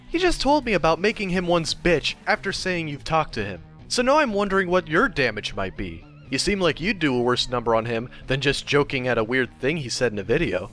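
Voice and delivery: ordinary delivery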